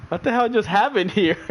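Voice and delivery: high, baffled outburst; mock bewildered. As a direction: imitate a stunned driver. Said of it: "And Tosh is like".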